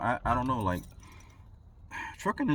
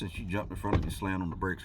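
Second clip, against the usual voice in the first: ordinary delivery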